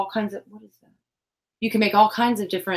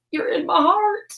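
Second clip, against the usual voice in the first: much higher, sing-song sweet; 11 semitones higher than usual. Real, not acted. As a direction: lift it up high and sweet.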